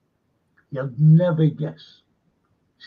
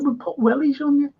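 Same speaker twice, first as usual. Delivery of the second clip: lifted much higher, playful retelling; mock high retold line; 10 semitones higher than usual. Actor quoting the director's reply, comic high voice. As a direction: quote the director in a squeaky comic voice.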